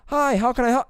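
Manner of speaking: high, bright recorded greeter; automated woman's voice. Retold or imitated. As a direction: play the canned female drive-thru voice. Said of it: "the voice was like this".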